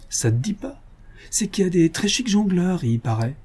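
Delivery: a hint of a cheeky young Parisienne; young cyclist girl. A narrator's reading.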